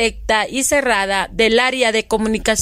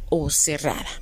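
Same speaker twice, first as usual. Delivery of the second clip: whisper; 8 semitones lower, 6 dB quieter than usual. Real, not acted. Whispered end of line.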